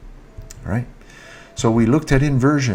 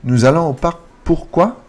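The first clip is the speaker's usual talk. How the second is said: higher, louder, "pourquoi" swept upward; rising intonation; 6 semitones higher, 5 dB louder than usual. Shown as a demonstration. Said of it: "It's a rising intonation".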